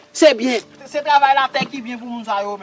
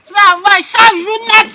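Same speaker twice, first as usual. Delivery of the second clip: stretched out slowly and louder, every word hit; slow emphatic complaint; 7 semitones higher, 6 dB louder, much slower than usual. Acted. Orphan complaining of daily field work, slow and stressed. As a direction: drag it out, pressing each word.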